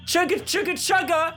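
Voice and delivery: chugging toy train voice. Acted. Conductor chugs in the distance.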